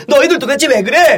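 high, self-righteous pupil scolding; mock class president. Class president stepping out to scold classmates, cringey reproach. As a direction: imitate a bossy class monitor. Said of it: "갑자기 반장, 부반장이 나와서".

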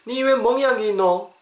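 high-pitched, startled questioning; alarmed dialect question. Acted. Friend asking about a bruise, high alarmed dialect. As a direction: demand an explanation in Korean dialect.

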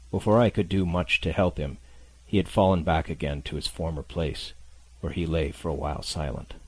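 usual speaking voice

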